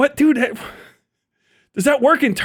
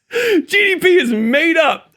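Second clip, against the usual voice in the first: higher, slow, laughing through the words; 7 semitones higher, slower than usual. Real, not acted. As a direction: wheeze it out while cracking up. Laughing at Asmongold's line.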